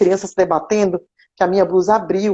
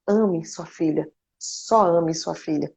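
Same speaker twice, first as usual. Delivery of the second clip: tender plea; 2 semitones lower, 6 dB quieter, slower than usual. Retold or imitated. Tells the young mother to love her baby.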